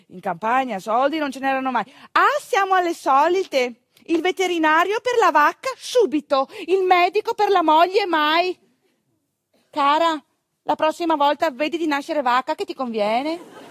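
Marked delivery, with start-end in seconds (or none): 2.1-13.6 mock outrage at a stingy husband; indignant scolding. Midwife scolds the husband who won't pay the doctor, indignant.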